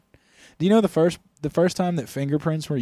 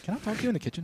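higher, hushed mom voice; mock parent aside; 7 semitones higher, 8 dB quieter, much faster than usual. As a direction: pull your partner aside like a mom. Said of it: "It's like".

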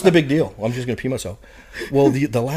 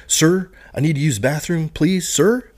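louder, higher formal plea; sir sandwich; 6 semitones higher, 6 dB louder, slower than usual. Retold or imitated. Recruit loudly asking drill instructor, sir sandwich. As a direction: boot recruit yelling it by the book. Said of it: "I'm like".

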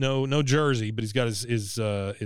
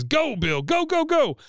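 mock cheering on; 14 semitones higher, slower than usual. Hank urging Bill at the sled, in a cheering yell. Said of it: "Hank says".

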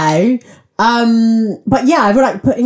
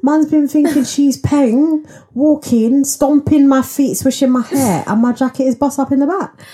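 mock dismay; 9 semitones higher than usual. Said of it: "that I was like".

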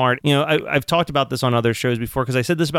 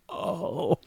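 small, soft, higher "oh"; crestfallen reaction; 5 semitones higher, 10 dB quieter than usual. Retold or imitated. Viewer seeing it's the little girl, softly crestfallen. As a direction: let it out softly, as if touched.

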